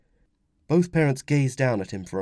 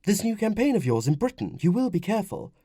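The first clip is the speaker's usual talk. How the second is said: high woman's voice, insisting; insistent wife; 10 semitones higher than usual. Acted. Wife to husband about campaign, insistent. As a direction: press the point as a concerned wife.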